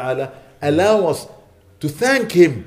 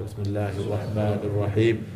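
Quran recitation; 4 semitones lower, faster than usual. Real, not acted. Imam leading the Fatiha for absent brothers, chanted recitation.

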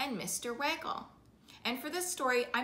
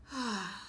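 sigh; 6 dB quieter than usual. Acted. No one answered, gives up.

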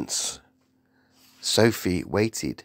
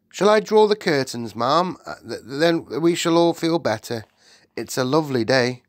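higher, eager servant voice; nervous maid; 5 semitones higher, 3 dB louder than usual. Acted. The maid offers to draw the curtains, nervous. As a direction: offer timidly, stumbling slightly.

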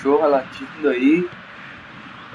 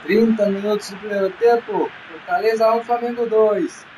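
fast radio football commentary; live play-by-play; 4 semitones higher than usual. Acted. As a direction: call the passes live. Play-by-play narration.

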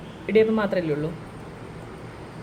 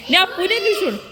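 laughing; 9 semitones higher, 4 dB louder than usual. Real, not acted. Laughing at the dog.

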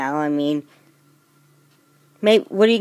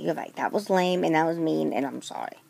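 usual speaking voice

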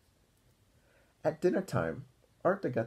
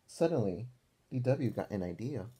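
usual speaking voice

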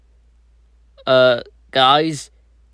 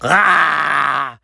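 pained cry. Acted. Villain hurt in the eye.

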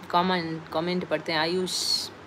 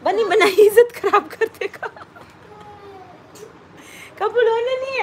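playful scolding; 11 semitones higher, 6 dB louder than usual. Real, not acted. Mother begging child not to embarrass her, playful scolding. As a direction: chide a little one, pitched way up.

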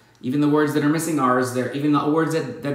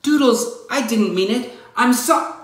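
high, louder anxious plea; pleading; 11 semitones higher, 5 dB louder than usual. Acted. Narrator pleading with her runaway pet, pitched high and loud. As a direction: call after the pet, pleading and sorry.

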